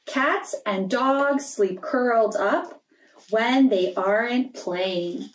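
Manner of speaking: gentle storybook read-aloud; story reading for children. Acted. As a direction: read it like a bedtime picture book. Reading a picture book aloud.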